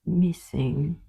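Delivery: plain reading voice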